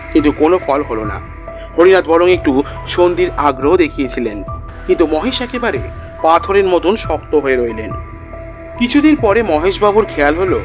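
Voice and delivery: plain reading voice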